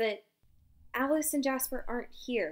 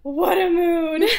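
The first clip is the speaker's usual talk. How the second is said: laughing through the words, slow; cracking up in delight; half-laughing exclamation; laughing exclaim; 7 semitones higher, 8 dB louder, slower than usual. Real, not acted. Reacting to article title.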